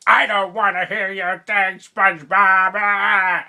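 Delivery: loud, high, drawn-out cartoon impression; Squidward. Fan mimicking Squidward's complaint to SpongeBob, loud high cartoon holler. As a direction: holler it slowly as an exasperated cartoon character. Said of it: "Squidward's hilarious 'cause he's like".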